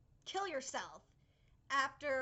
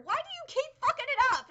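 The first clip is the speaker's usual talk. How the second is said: exasperated rant; 8 semitones higher, 9 dB louder, faster than usual. Real, not acted. Complaining about animation.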